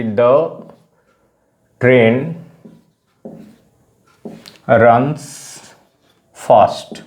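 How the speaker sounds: very long pauses after each word. Shown as a demonstration. Teacher contrasting a second example for learners, slow word by word. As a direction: dictate it one isolated word at a time.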